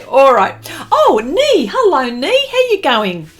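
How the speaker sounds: high, loud sing-song hellos; chirpy livestream welcome; bubbly greeting. Real, not acted. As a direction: greet each friend like they just walked in. Greeting viewers arriving in chat.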